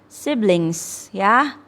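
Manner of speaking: "siblings" drawn out slowly and clearly; correct pronunciation. Shown as a demonstration. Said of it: "Yang benar adalah". (ucapkan "siblings" pelan dan benar)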